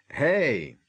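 big high shout from offstage; loud shout. A narrator's reading. Hagrid shouts at the kids near Buckbeak, loud. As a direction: yell to stop them. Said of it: "zazněl hlasitý výkřik".

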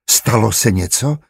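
usual speaking voice

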